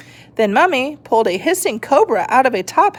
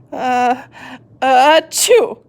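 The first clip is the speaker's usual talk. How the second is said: building sneeze, louder burst; 5 semitones higher, 4 dB louder, slower than usual. A narrator's reading. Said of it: "she sneezed a little bit louder".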